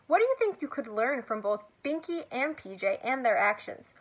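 usual speaking voice

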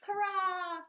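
high, bright little cheer; cheerful cheer. Real, not acted. Cheering about new beginnings.

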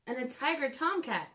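lilting storybook read-aloud; gentle storytime narration for little listeners; reading to children. Acted. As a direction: read it like a bedtime picture book. Reads picture book to children.

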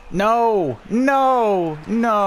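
high, loud repeated no; loud gamer outburst; frustrated shout. Real, not acted. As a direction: yell it at the screen in disbelief. Goal scored against him.

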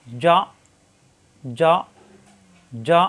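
যা clipped hard in the throat; hard stop. Shown as a demonstration. Teacher demonstrating a hard, clipped যা. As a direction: bite off যা with a throat catch. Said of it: "শক্ত করে উচ্চারণ".

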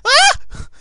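high, loud yelp; short real shriek; startled scream. Real, not acted. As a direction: cry out as danger suddenly appears. Startled in game.

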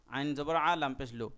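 ordinary delivery

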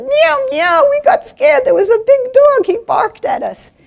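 very high, loud kitten voice; scared kitten. Acted. Kittens tell mother about the big dog, high scared mewling. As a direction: squeal it like a frightened little kitten. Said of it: "And the kitten said".

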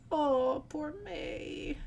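acted "poor thing" voice; pitying coo. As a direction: coo with drawn-out sympathy. Pitying the wailing drunk friend.

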